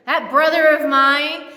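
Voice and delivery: angry outburst. Retold or imitated. Esau learns of the theft.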